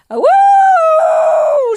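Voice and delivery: very high, long whooping shriek; full-force screechy whoop; exhilarated scream. A narrator's reading. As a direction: scream out as if rocketing upward. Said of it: "she screamed".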